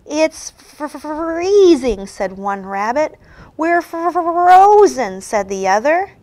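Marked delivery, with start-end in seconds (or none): 0.0-2.0 teeth chattering, stuttering from cold; shivering with cold. Acted. 3.6-5.2 shivering with cold. Acted.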